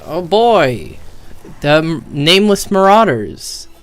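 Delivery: normal talking voice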